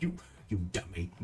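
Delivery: mock scolding. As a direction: mutter it under your breath. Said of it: "trying so hard to be like".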